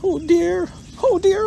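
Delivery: tiny high-pitched worried piglet voice; fretful Piglet. A narrator's reading. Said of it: "said Piglet to himself".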